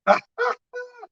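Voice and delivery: high burst of laughter. Real, not acted. Preacher amused by his own lockdown remark, laughing.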